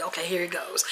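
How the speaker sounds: hushed whisper. Acted. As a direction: whisper it like a bedtime secret. Cozy story time setup.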